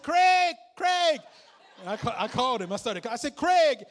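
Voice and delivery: loud, high urgent call of a name. Retold or imitated. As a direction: shout the name to get attention. Calling out to phone.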